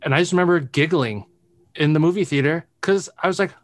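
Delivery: ordinary talk, nothing marked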